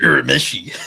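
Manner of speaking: "Urameshi" said loudly, falling at the end. Shown as a demonstration. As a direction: say "Urameshi" firmly the Japanese way. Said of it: "You have to say it".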